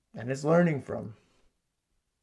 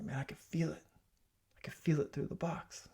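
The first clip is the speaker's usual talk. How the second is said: hushed awe; 3 semitones higher, 7 dB quieter than usual. Retold or imitated. UPS guy quoted feeling the energy.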